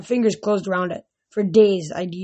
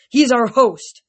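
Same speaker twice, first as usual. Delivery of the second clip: stern order; 4 semitones higher, 6 dB louder, slower than usual. A narrator's reading. Luke continuing his order.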